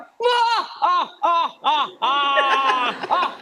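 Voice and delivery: hammed-up evil cackle, rolling on and on; cartoon villain laugh. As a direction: cackle like a cartoon baddie plotting. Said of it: "laughing like a cartoon villain".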